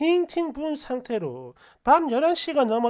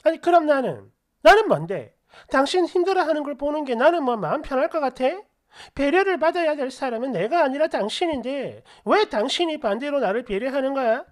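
loud, fast retold yell; 3 semitones higher, 5 dB louder than usual. Wife yells at husband during the fight, loud and fast. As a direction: shout it out at him, louder and faster. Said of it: "소리를 확 질렀습니다".

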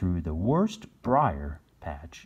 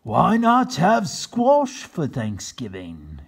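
very slow, louder proposal; captain's suggestion; 5 semitones higher, 6 dB louder, much slower than usual. Acted. Captain Bill proposing squash to the governor. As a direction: offer it hopefully. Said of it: "he asked".

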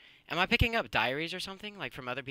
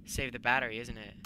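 ordinary talk

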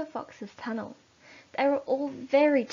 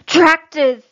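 very loud alarmed shout; Mister Fox shouting a warning to his family; 5 semitones higher, 18 dB louder than usual. Acted. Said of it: "shouted Mister Fox".

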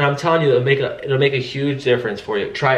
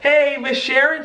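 higher, slow cheery hello; imitating Ryan's loud warmth; 13 semitones higher, slower than usual. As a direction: greet like an old friend. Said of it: "So he's really loud".

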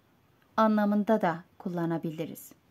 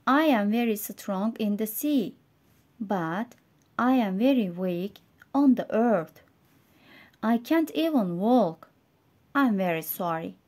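story reading for learners. A narrator's reading. Reads English story to learners.

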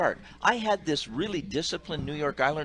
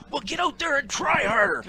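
much higher, louder coach's order; stern coach; 14 semitones higher, 5 dB louder than usual. Retold or imitated. Coach sending the ref back out, stern and loud. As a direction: push him back out like a fed-up dad. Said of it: "he said".

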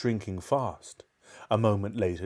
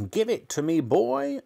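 put-on high, bossy old-woman yell; grandma yelling; 12 semitones higher, 4 dB louder than usual. A narrator's reading. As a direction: yell the demand, climbing in pitch. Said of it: "yelled Grandma".

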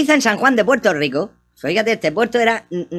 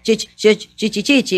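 imitación del anuncio. Retold or imitated. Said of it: "¿Cómo dice?".

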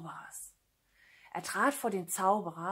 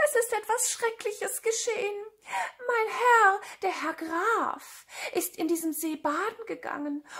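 high-pitched tearful cat voice; wailing lament; 12 semitones higher than usual. Acted. Cat tells the king of the theft, high wailing. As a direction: plead pitifully, high and whining, as if near tears.

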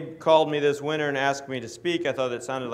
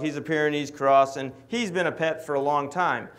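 usual speaking voice

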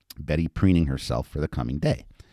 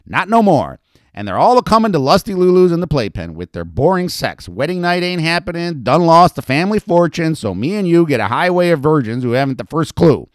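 much higher, louder rant; ranting madam; 12 semitones higher, 8 dB louder than usual. Acted. Lulu ranting to Betty about boring customers, loud and high. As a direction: hold forth loud and high.

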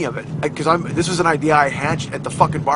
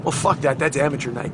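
usual speaking voice

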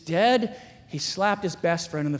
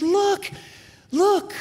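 put-on excited child; 14 semitones higher, 5 dB louder than usual. Daughter urging him to turn.

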